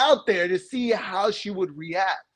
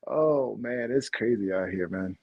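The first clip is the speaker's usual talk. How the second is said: usual speaking voice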